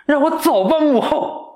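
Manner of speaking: high dramatic plea; mock pleading prince. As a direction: beg the queen theatrically.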